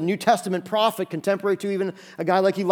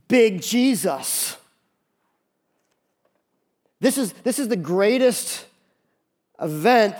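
higher-pitched, emphatic proclamation; impassioned preaching; 4 semitones higher than usual. Acted. Preacher announcing the coming of Jesus, impassioned. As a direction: proclaim it like momentous news.

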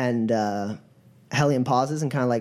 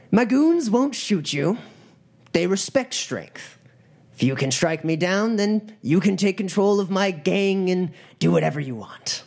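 commanding gang leader; 6 semitones higher than usual. Acted. Gang leader offering the troll his gang, commanding challenge. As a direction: lay down the challenge with deliberate weight.

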